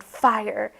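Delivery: a soldier yells the command; narrated yell. A narrator's reading. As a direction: shout the order fire. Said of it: "Someone yelled".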